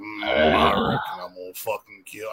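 low, quiet backwoods mumble; Sling Blade voice. Retold or imitated. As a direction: grunt it out like Karl from Sling Blade. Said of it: "he's like".

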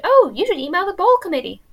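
put-on a touch of a high, loud American accent; brief mock American accent, dropped after. Quoting her American friend's suggestion, light American accent. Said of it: "I'm sorry, I won't do the American accent".

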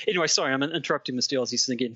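usual speaking voice

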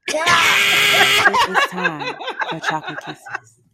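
hyped-up announcer. Acted. Segment intro, hyped and laughing.

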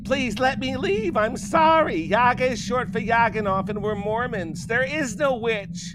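put-on high, desperate scared girl voice; terrified pleading girl. A narrator's reading. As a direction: beg for your life, near tears.

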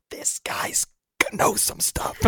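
whispered aside to the group. Real, not acted. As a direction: lean in and whisper it.

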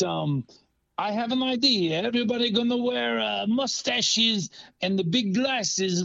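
put-on halting, improvised comic Italian accent; Mario. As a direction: play a cartoon Italian with a silly accent. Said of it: "boy, that's a really weird accent".

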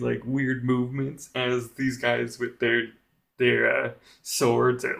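ordinary delivery

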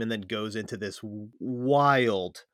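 ordinary talk

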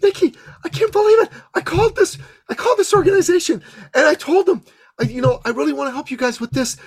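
high, breathless excited rush of news; thrilled report. Retold or imitated. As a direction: burst out with disbelieving joy. Julie excitedly reports her success.

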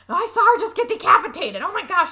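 high, loud acted alarm; mock panic. Witness to a decapitation, mock panic.

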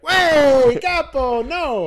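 high, loud wiseguy refusal; mock Italian-American guy. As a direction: brush it off like a cartoon mobster. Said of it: "continuing the Italian bit".

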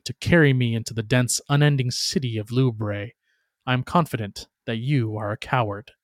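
ordinary delivery

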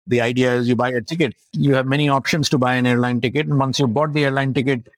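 ordinary talk

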